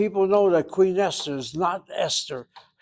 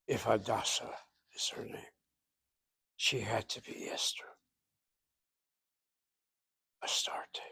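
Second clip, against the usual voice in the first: whisper; 6 semitones lower, 14 dB quieter than usual. Real, not acted. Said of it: "whispered lesson point".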